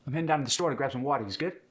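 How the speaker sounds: fast, pushing-forward Philadelphia accent; quick Philly rhythm. Shown as a demonstration. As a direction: push through it quickly, Philly style. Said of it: "rhythm is kind of pushing forward".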